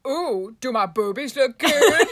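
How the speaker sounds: playful mock primping voice; mock girly voice. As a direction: ham it up like a vain model. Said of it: "be like".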